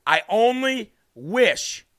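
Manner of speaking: stretched slowly with weight on only; slow drawn out. Shown as a demonstration. Host restarting his wish to viewers, slowly drawn out. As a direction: drag out I only wish for emphasis. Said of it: "I'm just gonna say".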